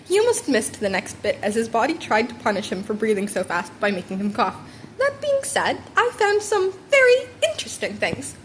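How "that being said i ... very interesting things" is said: much higher, teasing principal; playful hint of menace; Nezu voice. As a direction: sly reveal in a lightly voiced creature tone.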